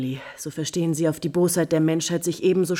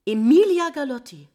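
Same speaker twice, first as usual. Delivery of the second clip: loud outburst; 6 semitones higher, 8 dB louder than usual. Acted. Countess realises the truth.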